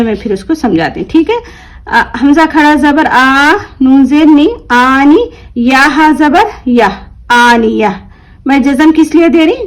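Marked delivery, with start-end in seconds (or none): 1.9-8.1 slow, measured letter-by-letter Quran drill; spelling-out recitation. Shown as a demonstration. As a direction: sound out each syllable, drawing out the long vowels. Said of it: "क्या करना है?". (हिज्जे करके धीरे-धीरे पढ़ना)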